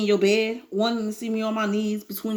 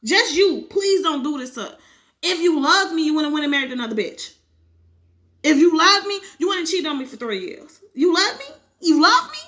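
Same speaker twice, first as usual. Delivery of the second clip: rising, louder anguished accusation; furious wife; 4 semitones higher, 4 dB louder than usual. Acted. Wife confronting cheating husband, furious and hurt. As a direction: hurl it at him, voice climbing higher.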